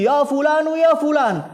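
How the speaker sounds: high, loud cry to the dead; imitated loud calling. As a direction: call out with all your voice. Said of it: "memanggil-manggil dengan suara-suara mereka yang paling tinggi".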